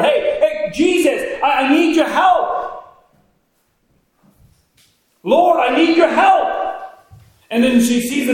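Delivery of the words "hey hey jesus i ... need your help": high, loud, slow cry for help; hollering plea. Retold or imitated. Mother calling out to Jesus over the crowd, hollering. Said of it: "she begins to holler".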